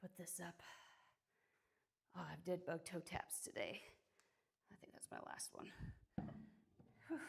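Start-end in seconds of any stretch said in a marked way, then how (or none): none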